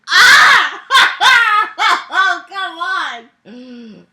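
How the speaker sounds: high screaming laugh into a protest; exasperated shriek. Real, not acted. Reader losing it over clumsy writing, exasperated shriek. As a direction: shriek in real disbelief, then groan it out.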